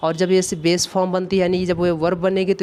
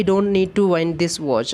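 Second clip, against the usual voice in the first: very slow, word by word; slow dictation; much slower than usual. Shown as a demonstration. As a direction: give students time to write. Reads the question slowly. (बहुत धीमे लिखवाते हुए)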